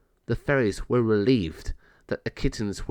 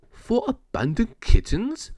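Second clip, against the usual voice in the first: astonished king; 11 semitones higher than usual. Acted. King Nosbor asks, astonished.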